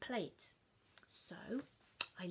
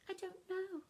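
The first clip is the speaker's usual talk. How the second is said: higher feigned innocence; innocent child; 9 semitones higher, 3 dB quieter than usual. Acted. Child to Mum, higher, innocent. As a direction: play dumb.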